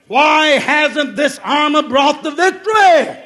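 declaimed Bible story with shouted peaks; full-force preaching. Acted. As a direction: preach David's story with mounting fervour. Tells David story at full force.

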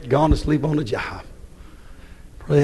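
ordinary talk

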